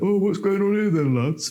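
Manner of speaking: high-pitched mock nosy onlooker; put-on high voice of an interrupting passer-by. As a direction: butt in high, imitating a nosy stranger. Mimicking nosy passers-by interrupting shoot.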